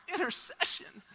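high, soft incredulous question; puzzled, high-pitched repeat; baffled inner reaction. Retold or imitated. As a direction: echo the word in mock disbelief. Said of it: "in my mind, I'm going".

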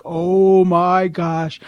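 slow, drawn-out amazement; awed reaction. Retold or imitated. Man just told Jesus has a message for him, slow awe. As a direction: stretch every word in awe.

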